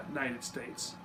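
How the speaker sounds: normal talking voice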